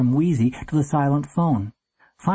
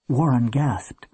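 ordinary delivery, nothing marked

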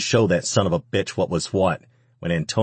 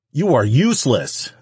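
raised, louder voice full of contempt; angry snarl; 7 semitones higher, 5 dB louder than usual. A narrator's reading. Abusive man berating the woman in their home, angry snarl. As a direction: snarl it at her with force. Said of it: "the man snarled".

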